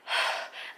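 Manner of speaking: a long unvoiced puff of breath; breathless sigh. Real, not acted. Winded from hauling stuff in, breathless sigh. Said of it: "I'm out of breath".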